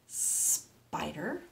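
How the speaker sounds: SPIDER with a lengthened S, rising at the end; long snake sound. Shown as a demonstration. Teacher reads to children, long S. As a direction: hiss into the word spider.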